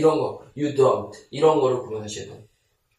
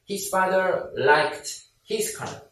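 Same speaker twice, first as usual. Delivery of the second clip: 1 slow model sentence; 3 semitones higher, much slower than usual. Shown as a demonstration. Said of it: "slow model sentence for learners".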